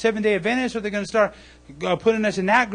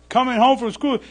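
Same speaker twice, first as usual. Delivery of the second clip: ordinary talk, nothing marked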